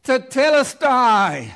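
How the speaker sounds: huge high shout, slow and drawn out; screamed cry. Retold or imitated. Jesus crying out from the cross, a slow ringing scream. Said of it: "he screams out".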